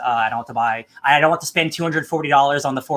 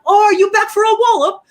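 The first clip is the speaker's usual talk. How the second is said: put-on squeaky teasing kid, much higher and louder; mocking kid voice; 6 dB louder than usual. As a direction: tease him like a cheeky little boy. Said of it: "He's like".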